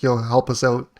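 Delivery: ordinary talk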